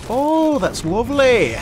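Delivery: high, loud delighted cry; delighted exclamation. Real, not acted. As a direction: squeal with joy at the find. Delighted at loot.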